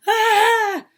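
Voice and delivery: sudden high yelp of fright; loud startled "Ah!"; startled shout. Acted. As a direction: jump out of your skin with a cry. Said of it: "Mickey shouted".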